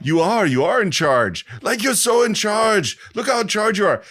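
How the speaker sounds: high, loud gushing excitement; excited Arnold. Retold or imitated. Podcast host imitating Arnold gushing over Die Hard, high and loud. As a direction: gush like an excited fan watching. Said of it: "Arnold, I can't do the accent, but said".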